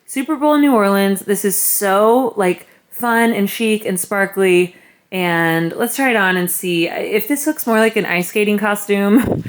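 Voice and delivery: loud, enthusiastic retelling of a thought; acting out own excited idea. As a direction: gush it out loudly, stretching "so".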